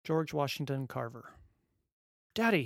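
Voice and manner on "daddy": put-on high, loud happy toddler squeal; gleeful little boy calling out; happy child shriek. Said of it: "he shrieked happily".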